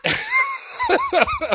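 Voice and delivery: high laughing curse that breaks into a long laugh; swear, then dissolve into laughter; embarrassed star caught out, laughing. Retold or imitated. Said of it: "he kinda laughs at himself about it".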